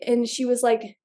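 normal talking voice

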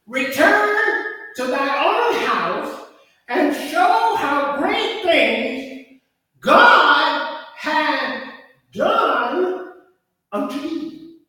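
slow, loud, weighty recitation of the verse; scripture reading. Acted. Preacher reading the verse to the congregation, slow and grand. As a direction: proclaim each word like a revelation. Reads scripture slowly, with emphasis.

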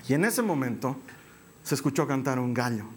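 ordinary talk

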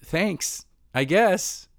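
rising, unsure mock thanks; the poor girl answering, mildly baffled; awkward girl. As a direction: hand back a reluctant, puzzled thanks. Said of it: "she's like".

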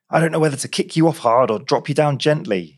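irate constable, pitch pushed up; mock-considerate growl. A narrator's reading. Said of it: "he growled considerately".

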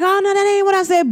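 quick, high-pitched mimicked denial; mock protest. People protesting to the speaker, mock protest. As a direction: rush out a high, defensive denial.

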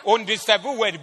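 loud, high-pitched rebuke from the pulpit; full-force preaching. Real, not acted. Preacher rebuking a silent church, full-force preaching. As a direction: shout the rebuke at the congregation.

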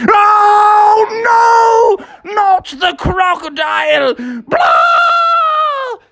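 very high, loud shriek; hammed-up terror of a pirate fleeing; panicked Hook. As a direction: wail it out, drawn out and screechy.

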